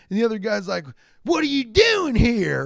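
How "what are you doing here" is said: high, far-off mock challenge; challenging yell. Other hillbilly squaring up to a rival, high mock challenge.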